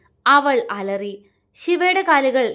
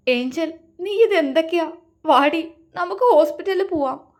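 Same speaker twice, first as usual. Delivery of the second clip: sobbing plea; 6 semitones higher than usual. A narrator's reading. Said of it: "പൊട്ടിക്കരഞ്ഞുകൊണ്ട് പറഞ്ഞു".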